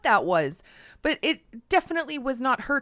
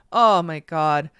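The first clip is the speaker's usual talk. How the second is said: ordinary delivery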